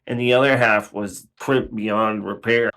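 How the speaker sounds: usual speaking voice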